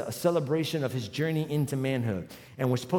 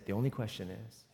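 quiet voice of God; 9 semitones lower, 13 dB quieter than usual. Retold or imitated. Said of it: "He said".

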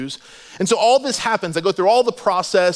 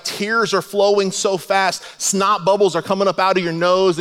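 ordinary talk

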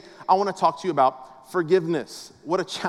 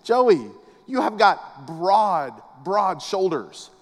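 slow, drawn-out praise of broad shoulders; admiring dad; much slower than usual. Retold or imitated. Dad admiring his son's shoulders, slow and drawn out. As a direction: savour each word with admiration. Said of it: "And I said".

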